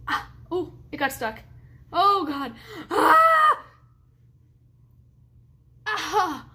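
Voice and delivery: sudden loud cry of real pain; pained cries. Real, not acted. Neck stuck mid-stretch, real pained yelp.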